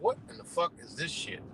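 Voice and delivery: hushed, breathy what-the-fuck under the breath; whispered disbelief. Retold or imitated. Recalling watching the Olympics, a whispered incredulous question. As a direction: mutter it like you can't believe your eyes. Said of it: "I'm like".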